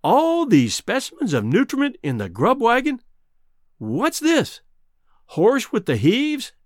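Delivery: high, incredulous questions; astonished. A narrator's reading. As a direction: read each item out in rising disbelief. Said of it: "with bulging eyes".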